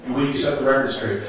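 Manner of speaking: ordinary delivery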